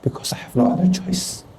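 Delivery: hushed, near-whispered tender voice; soft voice of God. Retold or imitated. God answering the despairing young pastor, hushed. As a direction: breathe it gently, almost whispered. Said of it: "Dan dia bilang".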